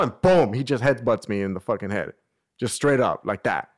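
ordinary talk

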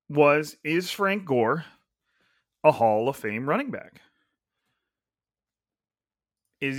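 slow, spaced-out question with long pauses; deliberately slow. Real, not acted. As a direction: lay the question out slowly, phrase by phrase. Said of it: "saying this slowly right now".

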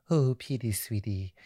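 lightly voiced doting mom, soft; Missus Doubtfire. Mom praising her son after the show, lightly done doting voice. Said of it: "why she Missus Doubtfire".

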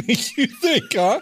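giggling through a fake ominous line; laughing mock conspiracy. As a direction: laugh it out like a joke theory.

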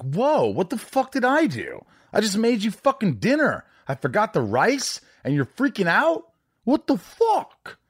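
playing the clueless boyfriend, high mock outrage; mock outraged guy. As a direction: squeal it like a guy who can't believe it. Imitating a typical guy's rant.

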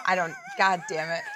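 ordinary talk, nothing marked

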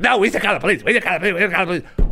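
high-pitched mock nagging; hammed-up fretting wife, repeating herself in a high voice; insistent wife. Wife insists to husband on calling police, mock nagging.

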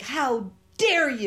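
mock the tantrum of an entitled man, drawn out; mock indignant outburst. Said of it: "screaming, yelling".

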